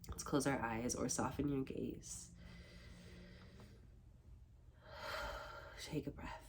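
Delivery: soft, hushed meditation guide; gentle cue to settle and breathe; guided meditation. Acted. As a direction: ease them quietly into stillness.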